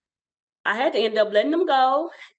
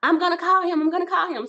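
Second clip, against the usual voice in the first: high, loud, urgent mother; a worried mother deciding to act; frantic mom; 8 semitones higher, 3 dB louder, faster than usual. Retold or imitated. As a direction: insist on calling the husband. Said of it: "she's like".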